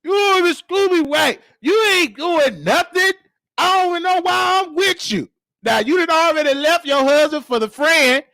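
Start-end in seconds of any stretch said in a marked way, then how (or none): 0.0-5.3 high, mocking shouted put-down; mama roasting him. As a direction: cut him down like mama did. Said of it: "She told him".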